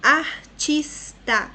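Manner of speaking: slow, syllable by syllable; separando as sílabas. Shown as a demonstration. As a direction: spell out each syllable slowly. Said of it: "São três impulsos de voz". (pronunciando sílaba por sílaba, bem devagar)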